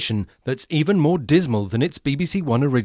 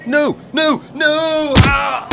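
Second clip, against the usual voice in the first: much higher, louder panicked "no"; panicked shout; 9 semitones higher, 5 dB louder, much slower than usual. Acted. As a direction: scream in terror.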